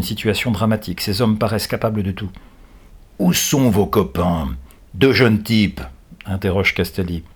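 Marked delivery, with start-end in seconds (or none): 3.2-5.8 slow, demanding villain voice; interrogating captor. A narrator's reading. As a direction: question them like a menacing captor.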